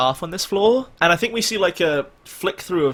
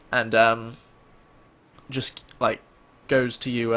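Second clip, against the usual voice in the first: usual speaking voice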